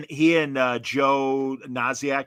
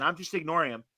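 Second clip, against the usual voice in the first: usual speaking voice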